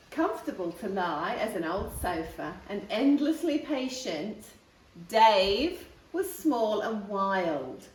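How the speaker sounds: ordinary delivery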